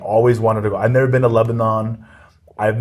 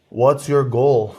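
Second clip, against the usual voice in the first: slow deadpan question, laughing; much slower than usual. Retold or imitated. Grandfather teasing a big man outside the club, slow and deadpan. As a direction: drag out the jab, deadpan.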